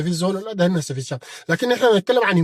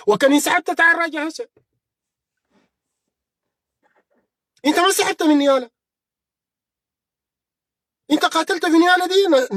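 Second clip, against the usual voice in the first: heated taunt; 9 semitones higher than usual. Real, not acted. Heated taunt of rival general.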